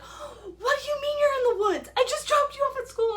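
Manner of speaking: high, crying shout of a frantic mother; panicked mom. Retold or imitated. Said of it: "and she's like".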